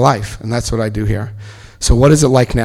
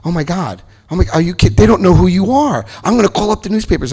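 high, agitated retold outburst; mock outrage; 7 semitones higher than usual. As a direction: imitate a worked-up friend, pitch climbing. Retells outraged friends phoning him.

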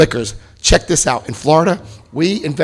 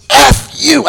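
higher, sudden mock scream; retold screamed curse; 10 semitones higher, 3 dB louder than usual. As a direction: hurl it out in a raised pitch. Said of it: "at the top of my lungs".

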